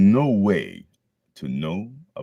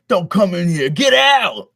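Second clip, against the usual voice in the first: higher, louder shouted voice; crowd yelling; 11 semitones higher, 9 dB louder than usual. Retold or imitated. Crowd yelling at Deku to leave, shouting. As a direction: yell them out. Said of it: "everybody's yelling".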